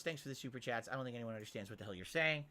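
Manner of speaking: ordinary talk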